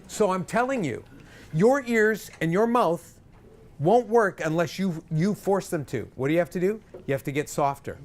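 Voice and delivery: usual speaking voice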